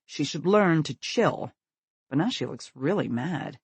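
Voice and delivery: usual speaking voice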